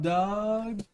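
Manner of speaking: ordinary talk, nothing marked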